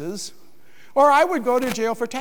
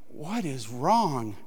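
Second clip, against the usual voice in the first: slow, soft and low, gently asking; soft worried question; 7 semitones lower, 6 dB quieter, much slower than usual. Retold or imitated. Husband gently asking his sobbing wife, soft and slow. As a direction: ease into it softly, like approaching someone crying.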